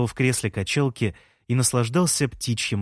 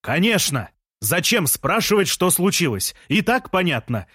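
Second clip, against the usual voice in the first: higher indignant shout; 6 semitones higher than usual. A narrator's reading. Jean shouting at the silent narrator. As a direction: yell it out sarcastically. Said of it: "закричал он".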